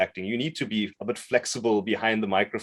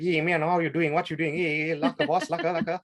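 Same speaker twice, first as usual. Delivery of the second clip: higher-pitched put-on Indian accent; 7 semitones higher than usual. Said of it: "like, the stereotypical Indian voice".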